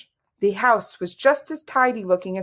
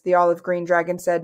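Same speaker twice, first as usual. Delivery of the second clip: ordinary delivery, nothing marked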